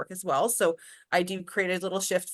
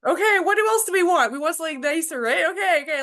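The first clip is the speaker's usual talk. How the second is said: excited coaching; 10 semitones higher, 6 dB louder than usual. Retold or imitated. Continues excited coaching quote.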